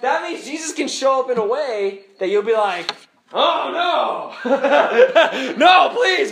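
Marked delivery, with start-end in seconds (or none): 3.3-6.3 loud, high cry of dread; hammed-up begging, breaking into laughter; mock dismayed plea. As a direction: wail it in comic protest. Acts dismayed believer pleading with God.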